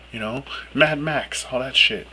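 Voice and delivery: usual speaking voice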